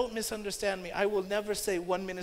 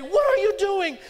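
loud, much higher outraged shout; indignant shout; 10 semitones higher, 10 dB louder than usual. Retold or imitated. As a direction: yell to stop the beating. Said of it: "had to say".

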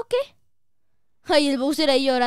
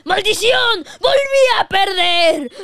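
loud, higher, drawn-out villain wail; Bowser wailing in defeat; 7 semitones higher, 8 dB louder, slower than usual. Acted. Bowser falling after losing once more, loud wailing villain cry. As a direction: howl the defeat out slowly.

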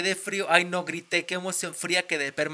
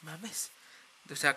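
ordinary delivery

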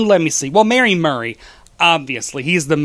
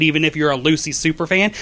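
ordinary delivery

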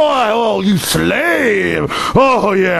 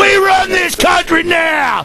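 higher, shouted swagger; shouted cop boast; 7 semitones higher than usual. Retold or imitated. Radio host imitating a cop boasting of power, shouted. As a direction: yell it like the cop owns the place. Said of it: "that's an imitation of 'em".

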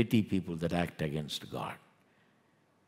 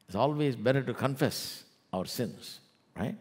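ordinary talk, nothing marked

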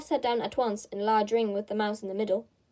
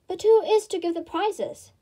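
chorus of voices; 8 semitones higher, 4 dB louder than usual. A narrator's reading. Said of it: "quite a chorus of voices asked".

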